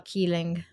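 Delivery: "keeling" with a hard k for the c; literal misreading. Shown as a demonstration. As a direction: say "keeling", misreading ceiling slowly. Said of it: "so you can say ceiling".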